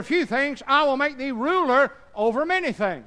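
high, loud, slow solemn proclamation; the Lord's voice. Retold or imitated. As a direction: proclaim it like a king. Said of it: "his Lord said unto him".

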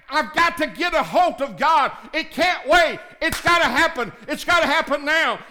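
can't wait, it has to happen now; urgent plea. Acted. Acted urgent plea to God.

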